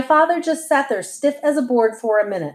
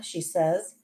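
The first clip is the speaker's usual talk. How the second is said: ordinary delivery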